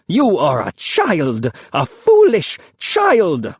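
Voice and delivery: slow, building to a high loud rebuke; a harsh elder shaming the boy; scolding. A narrator's reading. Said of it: "Saluyad was scolding".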